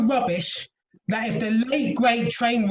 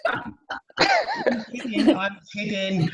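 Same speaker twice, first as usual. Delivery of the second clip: laughing; much slower than usual. Real, not acted. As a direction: laugh it off, drawn out. Joke retracted with a laugh.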